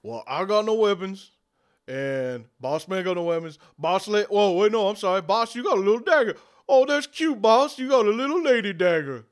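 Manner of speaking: lightly voiced mocking henchman; teasing orc voice. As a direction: poke fun at the boss's dagger. GM voicing Boris teasing.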